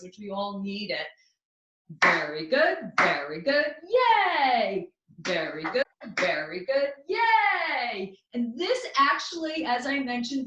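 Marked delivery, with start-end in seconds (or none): 2.0-8.2 put-on childlike playfulness. Shown as a demonstration. Teacher demonstrates an affirmation to ourselves, playful cheering chant. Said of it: "bring in that childlike playfulness".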